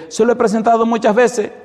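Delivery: high-pitched retold protest; acted protest. Preacher imitates husband defending himself to the pastor, high protest.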